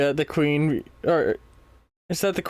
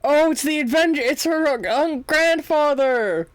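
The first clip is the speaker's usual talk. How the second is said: high, loud excited outburst; delighted gasp of recognition; excited realisation; 10 semitones higher, 3 dB louder than usual. Real, not acted. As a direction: burst out as it clicks.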